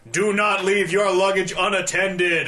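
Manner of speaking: loud, slow mock airport announcement, pitched high; booming intercom voice. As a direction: boom it out like a stern terminal announcer. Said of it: "this booming voice comes over the intercom".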